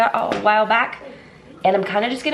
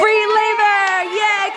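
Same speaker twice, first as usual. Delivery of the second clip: higher, slower, louder cheer; big cheer; 10 semitones higher, 4 dB louder, much slower than usual. Real, not acted. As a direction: really sell the cheer.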